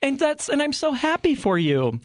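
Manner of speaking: high-pitched put-on cheer; mock-delighted, sing-song high; gushing congratulation. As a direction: gush in an exaggerated high voice.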